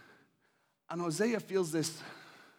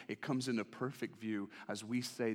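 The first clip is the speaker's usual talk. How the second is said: ordinary talk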